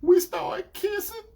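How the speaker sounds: put-on high, slow girlfriend voice; retold girlfriend. Girlfriend admitting to kissing, imitated high and slow. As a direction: imitate her drawing out the confession. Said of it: "She said".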